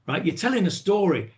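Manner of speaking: usual speaking voice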